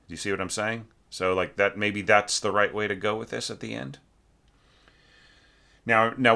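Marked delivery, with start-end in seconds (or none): none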